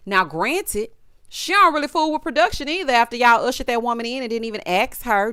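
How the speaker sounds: ordinary delivery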